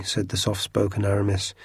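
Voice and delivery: ordinary delivery, nothing marked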